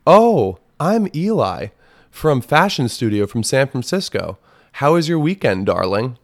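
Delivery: mock sugary stranger texting darling; prissy scammer voice. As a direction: ham up the scammer's sweetness. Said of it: "The person says".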